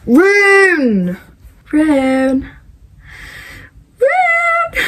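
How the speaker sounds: long swooping cry, then a very high squeal; fangirl cries. Real, not acted. Reader gushing over a favourite character, fangirl cries. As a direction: squeal the beloved name, drawn out.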